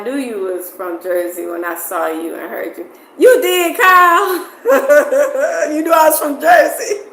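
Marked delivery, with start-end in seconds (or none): 3.2-7.0 loud, high, laughing exclamation; thrilled squeal of recognition, breaking into laughs; delighted surprise. Real, not acted. Delighted at being recognized as Jersey.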